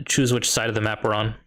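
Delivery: usual speaking voice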